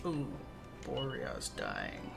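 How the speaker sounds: ordinary talk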